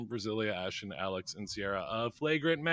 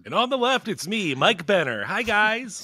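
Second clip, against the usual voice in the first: mock announcer; 9 semitones higher, 6 dB louder than usual. Mock royal introduction.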